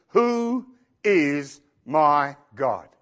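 "Who is my God" drawn out word by word; deliberate self-questioning. Acted. Preacher models asking yourself, "Who is my God" slow and deliberate. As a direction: ask "Who is my God" very slowly, weighing each word. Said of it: "ask yourself the question".

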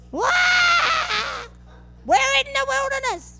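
high, hammed-up whiny wail; mock wailing. Pastor mocking the grumbling Israelites, high mock wail. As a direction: wail like a spoiled complainer. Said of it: "wailing all night long".

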